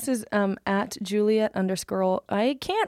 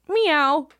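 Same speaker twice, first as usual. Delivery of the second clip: mock cat meow; 5 semitones higher, 3 dB louder than usual. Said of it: "fucking Ian said".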